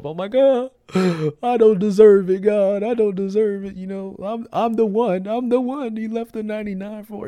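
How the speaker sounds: high, loud and breaking with tears; tearful outburst. Retold or imitated. Alone at home after the song, crying out to God. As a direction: cry it out, overwhelmed and unworthy. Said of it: "I'm tore up from the floor".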